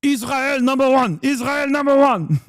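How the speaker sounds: high-pitched put-on Middle Eastern accent; mock regional accent. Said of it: "he has a Middle Eastern regional dialect".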